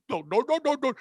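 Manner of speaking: hammed-up Indian accent, repeated noes; mock Indian accent. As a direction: put on a rough Indian accent. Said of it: "I'd be talking like that".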